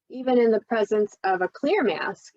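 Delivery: normal talking voice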